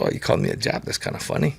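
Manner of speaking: soft breathy amusement through a chuckle; amused, laughing reply. Retold or imitated. Telling the man who insulted him, laughing it off. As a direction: laugh it off quietly as if unbothered. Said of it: "I just laugh".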